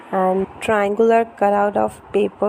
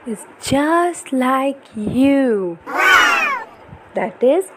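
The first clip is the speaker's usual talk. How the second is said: put-on lively, sing-song storybook reading for a toddler; reading to children; 3 semitones higher, slower than usual. Parent reading an ocean picture book to baby, animated storytelling. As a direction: read it brightly to hold a little one's attention.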